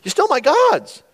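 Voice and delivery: high-pitched indignant outburst; hammed-up outraged accusation; ticked off. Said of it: "Now he's really ticked about".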